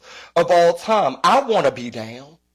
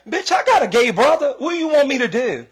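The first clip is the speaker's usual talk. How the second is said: sassy retort; 8 semitones higher than usual. Real, not acted. Retort to quoted ally.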